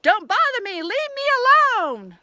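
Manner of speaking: high, loud snappy yell, leave me alone stretched out; yells back. A narrator's reading. Brick house pig yelling at the wolf, high and loud. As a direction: shout through the door. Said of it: "yells back".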